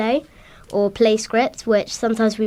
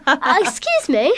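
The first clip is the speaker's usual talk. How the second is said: mock offended; 6 semitones higher than usual. Real, not acted. Mock offence at proper job.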